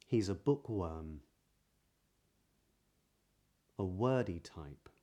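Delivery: measured reading with rounded W in "William never wants"; practice sentence. Shown as a demonstration. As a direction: round the W of "wordy", long pauses between phrases. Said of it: "here are some sentences".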